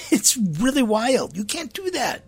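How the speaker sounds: high-pitched protest through a laugh; amused disbelief. Real, not acted. Priest reacting to people changing the letters, laughing disbelief.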